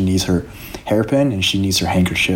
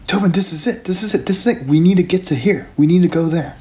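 higher mock-scream of discovery; excited yelling; 9 semitones higher than usual. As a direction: shout the discovery, bouncing. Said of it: "Sean just starts screaming".

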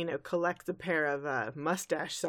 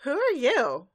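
high, louder startled question; 7 semitones higher, 6 dB louder than usual. Retold or imitated. Twilight to her future self, startled. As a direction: gasp it out in surprise. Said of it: "And she's like".

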